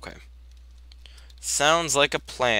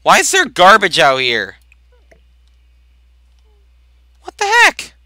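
loud, high, shocked exclamation, jumping way up on heck; a sudden startled yell at the garbage; startled outburst; 9 semitones higher, 14 dB louder than usual. Retold or imitated. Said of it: "I thought".

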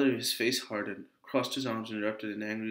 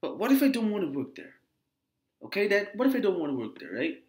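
higher-pitched, insistent pushback; speaking up; 7 semitones higher than usual. Acted. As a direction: speak up clearly and plead your case. Daughter repeats herself after mumbling rebuke.

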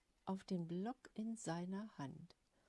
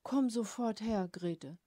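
loud command; 7 dB louder than usual. A narrator's reading. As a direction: order his wife over at once. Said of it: "befahl er". (laut befehlend)

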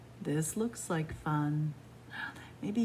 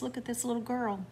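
plain reading voice

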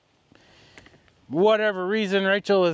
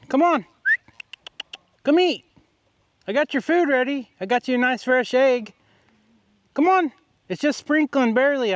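coaxing call; 4 semitones higher than usual. Real, not acted. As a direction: coax the scared dog out. Coaxing the dog to eat.

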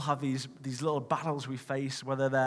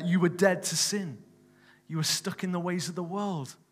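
raised, fervent scripture reading; preaching; 3 semitones higher, 3 dB louder than usual. Acted. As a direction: build the verses with rising conviction. Preaching scripture over music.

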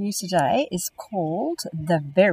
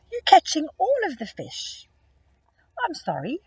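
a higher, apologetic polar bear voice; polite polar bear saying sorry, pitched higher; kind polar bear; 9 semitones higher, 4 dB louder than usual. Acted. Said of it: "what a kind polar bear".

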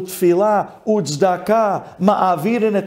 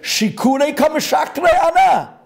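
much higher, a hammed-up outburst; indignant shout; 9 semitones higher than usual. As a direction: throw the accusation at him. Angry retort quoted from Gemara.

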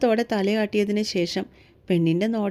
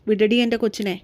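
ordinary delivery

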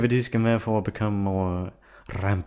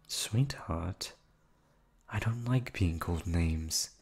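softer and slow, sweet on top with menace beneath; quiet cold warning; 2 semitones lower, 5 dB quieter, slower than usual. Acted. Captor quietly warning her captive against insults, soft slow menace.

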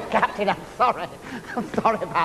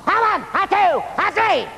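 shouted drill count; 9 semitones higher than usual. Shown as a demonstration. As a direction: yell the count like a sergeant. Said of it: "care to demonstrate".

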